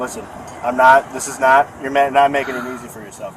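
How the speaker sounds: stern loud warning. Real, not acted. Officer scolds resisting suspect.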